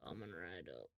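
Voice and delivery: very low, hushed waiter voice. Acted. Waiter takes the order, deep and quiet.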